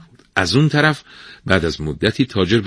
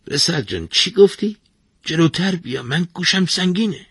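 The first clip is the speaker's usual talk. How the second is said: put-on deaf old man; 11 semitones higher than usual. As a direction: call out like a hard-of-hearing old man. Half-deaf old bath keeper speaks.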